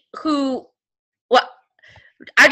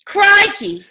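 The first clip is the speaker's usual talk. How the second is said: a light attempt at an Australian accent; 6 semitones higher than usual. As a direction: say it like a beginner trying Australian. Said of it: "I know that was not a good Australian accent".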